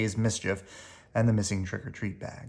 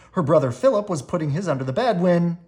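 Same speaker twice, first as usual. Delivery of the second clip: usual speaking voice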